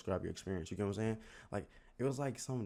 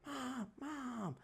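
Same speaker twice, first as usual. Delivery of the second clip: very high, softer squeaky calling; 6 dB quieter than usual. Retold or imitated. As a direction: call mom faintly in a tiny voice. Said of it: "just keep screaming that shit".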